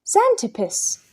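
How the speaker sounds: very high, startled blurt; stunned boys blurting his name; stammered surprise. A narrator's reading. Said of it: "the boys all stammered".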